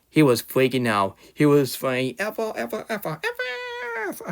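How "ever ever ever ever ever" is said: hammed-up high whiny ever; mock screaming. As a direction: imitate his screaming in a thin high voice. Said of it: "Nobody want to hear you screaming".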